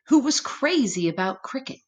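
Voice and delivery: usual speaking voice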